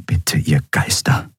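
slow, hushed plea; pleading. A narrator's reading. Nelen praying to the spirits for her friends, pleading. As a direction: plead slowly, half under the breath. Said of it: "flehte Nelen".

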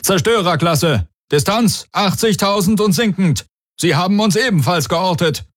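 hohe, drängende Stimme eines Offiziers; Meldung eines Besatzungsmitglieds. A narrator's reading. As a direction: melde mit deutlich erhöhter Stimme. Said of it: "meldete einer von Darayos Leuten". (dringende Meldung)

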